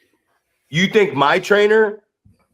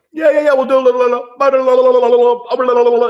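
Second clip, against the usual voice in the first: high, loud, babbling mockery; mock the chatty guys in a silly high voice; mocking chatter; 7 semitones higher, 5 dB louder, faster than usual. Comedian mocking wrestlers who talk too much, high silly babble. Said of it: "they're like".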